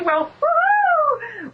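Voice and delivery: a high, elated whoop; joyful whoop. Real, not acted. Host welcoming listeners to her dream, elated whoop. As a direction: cheer as if the dream just came true. Dream show finally starting.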